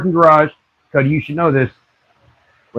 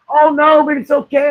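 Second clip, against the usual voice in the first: high, sing-song fake soothing; mock cooing nurse; 12 semitones higher than usual. Nurse placating the wife who wants to go home, high mock cooing. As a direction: croon it high like calming a toddler. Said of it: "staff voiced in retold story".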